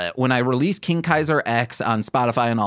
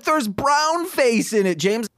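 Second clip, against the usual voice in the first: put-on high, slow, scolding girlfriend; exasperated girlfriend; 9 semitones higher than usual. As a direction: call him out in a high voice. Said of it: "And she's like".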